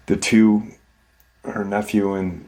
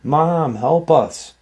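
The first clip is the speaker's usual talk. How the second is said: imitating a boy's cry for help, higher-pitched; mild retold scream; 5 semitones higher than usual. Youngest son screams to mom for help, mild mock scream. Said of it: "youngest son scream".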